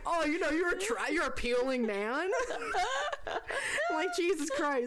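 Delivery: talking through high laughter; laughing. Real, not acted. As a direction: crack up while acting out the line. Cracking up while retelling the scene.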